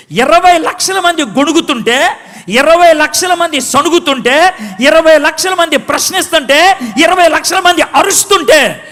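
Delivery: preaching at full force to the congregation; fiery preaching. Acted. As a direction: hammer home the crowd of millions. Preaching builds.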